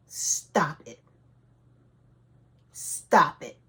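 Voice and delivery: stern, spaced-out order; firm command to stop. Retold or imitated. God telling the screaming mother to stop, stern command. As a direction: cut the outburst off firmly.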